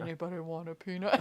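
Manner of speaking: low, soft, quick giant voice; badly faked deep giant voice; attempted deep giant. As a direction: drop low for a mock giant. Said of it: "I can't even do it".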